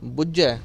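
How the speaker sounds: ਬੁੱਝੈ with a tight doubled ਝ and dulavan ending; ਬੁੱਝੈ ਕੱਸ ਕੇ; correct pronunciation. Shown as a demonstration. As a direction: press the ਝ in ਬੁੱਝੈ firmly. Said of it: "ਕੱਸ ਕੇ ਕਰਨਾ ਹੈ".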